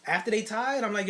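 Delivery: ordinary delivery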